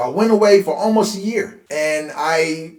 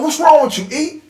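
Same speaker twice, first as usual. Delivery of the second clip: higher, louder retold yell of the scared older brother; retold brother's yell; 11 semitones higher, 6 dB louder than usual. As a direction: shout it at the little brother who fell. Older brother yelling after shooting.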